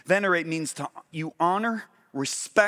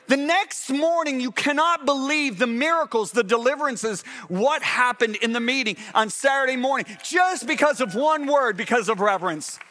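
full-force preaching; 6 semitones higher than usual. Acted. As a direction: preach it to the rafters, slowing for weight.

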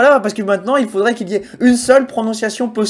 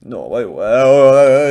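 low, drawn-out grumble swelling louder; mock grumbling; 8 semitones lower, 6 dB louder, slower than usual. Streamer brushing off viewers who think ads, mock grumbling. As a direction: grumble in exaggerated, low protest.

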